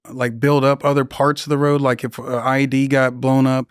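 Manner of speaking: ordinary talk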